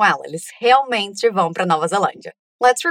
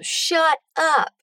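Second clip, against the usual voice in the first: higher, stunned shut up; mock amazed shut up; 7 semitones higher than usual. Mia learns she is a princess, amazed. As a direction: gasp it out in disbelief. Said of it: "Ela diz exatamente isso".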